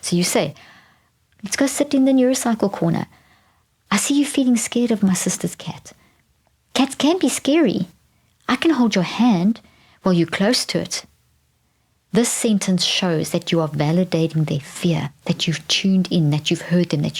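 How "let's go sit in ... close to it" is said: put-on soft, high, soothing words to a scared child; coaxing, tender and light; gentle parent voice. Shown as a demonstration. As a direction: talk gently, reassuring the child. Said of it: "soft voice".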